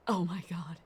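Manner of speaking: whispered in real awe; awed whisper. Real, not acted. Streamer awed by the Sin Eater, whispering. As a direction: breathe it out in a whisper.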